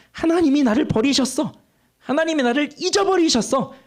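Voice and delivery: high complaining cry. Retold or imitated. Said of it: "이렇게 말하고 있는 거예요".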